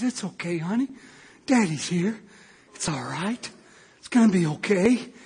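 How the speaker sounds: low, hushed soothing father; tender reassurance. Retold or imitated. Father comforting his sick baby.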